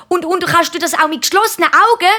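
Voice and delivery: hohe, eifrige Bubenstimme; Kinderstimme. A narrator's reading. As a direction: hak hoch nach wie ein Kind. Markus fragt den Papi. (hochi Buebestimm)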